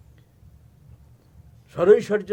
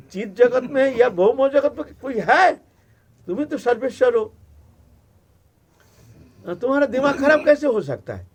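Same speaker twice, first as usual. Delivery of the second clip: incredulous Parvati; 6 semitones higher, 6 dB louder than usual. Acted.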